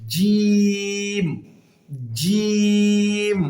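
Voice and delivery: "জিম" held long on one level note, tongue middle to palate; drawn-out jim. Shown as a demonstration. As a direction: stretch the vowel of "জিম", then close on the m. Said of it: "জিহ্বার মাঝখানটাকে আমি উপরে লাগাবো". ("জিম" জিহ্বার মাঝখান তালুতে লাগিয়ে লম্বা টেনে)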